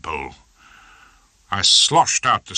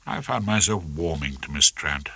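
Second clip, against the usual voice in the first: plain reading voice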